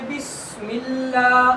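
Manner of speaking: "بسم اللہ" with a held s and long open "laa"; firm teacher's chant of "بسم اللہ", vowels held; open-mouthed, forceful articulation. Shown as a demonstration. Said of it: "منہ کھولو، اچھی طرح زور سے".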